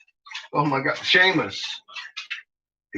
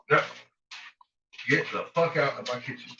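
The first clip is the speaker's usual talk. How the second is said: ordinary talk, nothing marked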